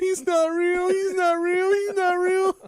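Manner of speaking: high, loud, drawn-out sob-shout; wailing child. Retold or imitated. Little sister wailing on the restaurant floor, high and loud. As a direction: wail it like a kid breaking down in public.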